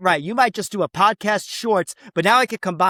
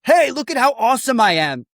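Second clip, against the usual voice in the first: bragging, pitched up high; mock boastful; 7 semitones higher, 3 dB louder than usual. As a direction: show off like a smug influencer. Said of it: "and then saying".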